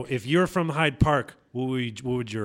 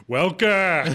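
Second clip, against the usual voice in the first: put-on Welker in a broad Boston accent, louder and higher; 6 semitones higher, 6 dB louder than usual. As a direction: give it the full Boston sports-fan drawl. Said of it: "Here's the Boston one".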